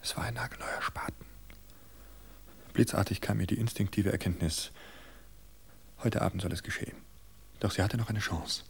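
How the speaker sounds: plain reading voice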